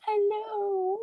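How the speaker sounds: high cooing hello. Real, not acted. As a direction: coo at the puppy. Greeting a puppy on camera.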